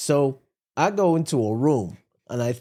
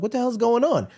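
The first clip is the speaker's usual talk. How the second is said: high startled what-the-hell; baffled outburst; 7 semitones higher than usual. Retold or imitated. Husband finding the chair reclined, high baffled outburst. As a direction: pitch up in confusion. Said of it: "I'm like".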